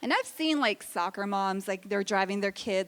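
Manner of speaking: ordinary talk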